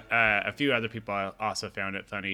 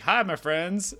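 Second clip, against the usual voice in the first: mock Spanish accent; 8 semitones higher, 4 dB louder, slower than usual. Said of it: "really bad Spanish accent on purpose".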